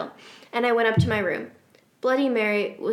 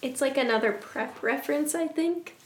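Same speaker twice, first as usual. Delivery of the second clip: ordinary delivery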